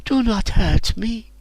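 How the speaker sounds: high, slow pleading voice; high pleading slave girl; pleading captive girl. A narrator's reading. Bound slave begs for mercy.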